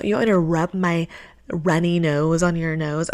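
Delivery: ordinary talk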